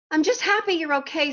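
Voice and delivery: relieved Dodsworth in a put-on British accent; lightly British, glad he is safe. Teacher voicing Dodsworth relieved, in a light British accent. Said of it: "I hope you enjoyed my accent".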